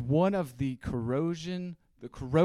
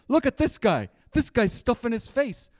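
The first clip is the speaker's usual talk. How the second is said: high, loud heckling holler; mock callout; 8 semitones higher, 5 dB louder than usual. Someone pointing out your stuffed face, high mock callout. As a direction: call it out high like a brash onlooker. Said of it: "Someone would say".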